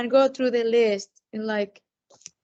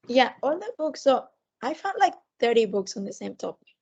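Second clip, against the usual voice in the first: ordinary delivery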